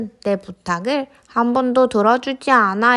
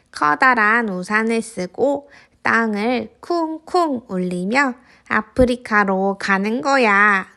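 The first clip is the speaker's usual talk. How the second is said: plain reading voice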